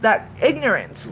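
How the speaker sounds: ordinary delivery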